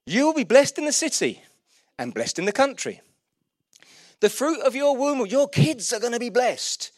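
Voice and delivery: high, loud, driving preacher delivery; rousing sermon climax, loud and urgent; impassioned preaching. Real, not acted. Declaiming scripture blessings.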